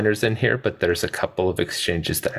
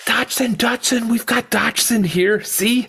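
mock Nedry; 13 semitones higher than usual. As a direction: needle him in a high squeaky mocking voice. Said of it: "And he goes".

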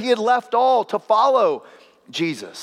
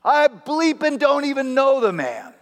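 swearing denial; 7 semitones higher than usual. Retold or imitated. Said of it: "And Peter swears".

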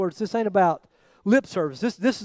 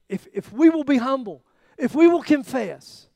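pleading prayer; 7 semitones higher, 5 dB louder than usual. Retold or imitated. Prayer voiced in sermon.

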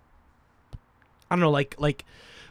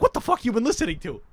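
incredulous; 6 semitones higher than usual. Retold or imitated. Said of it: "And I'm like".

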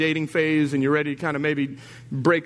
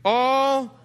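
leading the call; 9 semitones higher, 4 dB louder than usual. Shown as a demonstration. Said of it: "say these next three words out loud".

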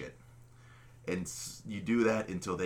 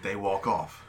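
normal talking voice

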